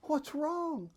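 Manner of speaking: high, soft, sweet imitation of a mother; sweetest motherly voice. Mother comforting her crying child at bedtime, very sweetly. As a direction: coo gently like a doting mom. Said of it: "in her sweetest voice".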